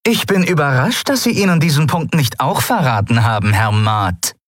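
high mock surprise, falling at the end; dripping with acid sarcasm; caustic sarcasm. A narrator's reading. Captain accusing her mate of betrayal, caustic sarcasm. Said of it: "Der Sarkasmus in Zaylars Stimme war ätzend wie Säure".

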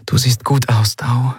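light, half-whispered aside; whispering. A narrator's reading. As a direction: murmur it close, partly whispered. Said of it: "flüsterte sie".

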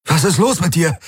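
high, breathless challenge; winded and urgent; panting. A narrator's reading. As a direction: gasp out an alarmed question. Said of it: "keuchte Garland".

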